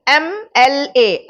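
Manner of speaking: slow, letter by letter M L A; slow spelled letters. Shown as a demonstration. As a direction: spell out M L A slowly for the class. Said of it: "अब इसमें क्या sound आई".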